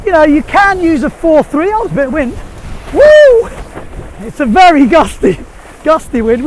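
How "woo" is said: loud, high whoop of joy; excited whoop. Real, not acted. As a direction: let out a thrilled woo as the wind hits.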